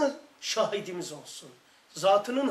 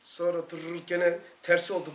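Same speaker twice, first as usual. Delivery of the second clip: usual speaking voice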